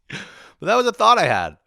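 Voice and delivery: loud, high outburst; incredulous. Real, not acted. Comic owning up to his absurd thought, incredulous. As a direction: exclaim it in laughing disbelief.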